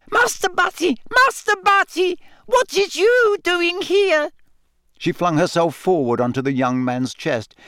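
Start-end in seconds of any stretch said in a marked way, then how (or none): 0.1-4.3 very high, squealing house-elf voice; piercing elf shriek. A narrator's reading. House elf finding her young master on the floor, piercing shriek. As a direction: shriek it like a frantic little creature. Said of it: "she let out a piercing shriek".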